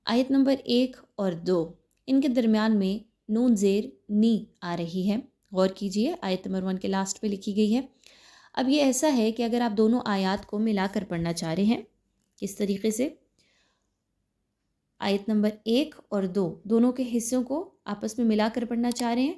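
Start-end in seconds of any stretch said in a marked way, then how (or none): none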